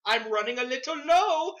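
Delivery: exaggerated Indian-accented line; imitated Indian accent. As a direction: put on an Indian accent, rising in pitch. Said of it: "You did the voice".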